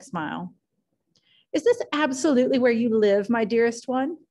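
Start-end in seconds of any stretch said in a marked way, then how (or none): 1.5-4.2 put-on higher, brighter society-girl lilt; a lively Daisy, voiced up high; Daisy's rippling voice. A narrator's reading. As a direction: thrill at seeing him in the rain. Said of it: "The exhilarating ripple of her voice".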